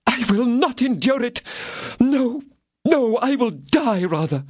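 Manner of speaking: high, indignant cry of refusal; defiant outrage. Acted. Queen refusing the Chancellor's search, high defiant cry. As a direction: refuse him with wounded royal pride. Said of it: "the imperial blood ... revolted at the".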